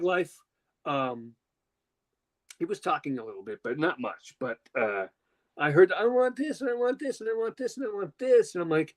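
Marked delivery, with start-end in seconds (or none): 5.9-8.5 put-on singsong chant of a demanding child; whiny-demanding kid. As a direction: imitate a kid begging over and over. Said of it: "talking about the kid doing".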